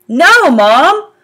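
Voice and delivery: sharp, loud no; quick protest. A narrator's reading. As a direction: cut her off fast. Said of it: "I said quickly".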